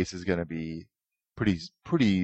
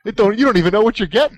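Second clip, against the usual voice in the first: excited; 13 semitones higher, 8 dB louder, faster than usual. Real, not acted. Mocking the new magazine name.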